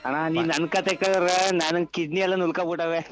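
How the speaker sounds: normal talking voice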